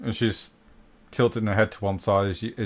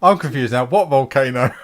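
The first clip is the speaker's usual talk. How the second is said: loud, playful bewilderment; mock confusion; 4 semitones higher, 9 dB louder than usual. Game master joins Bongo's confusion about volcano, loud mock bafflement. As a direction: protest loudly in pretend confusion.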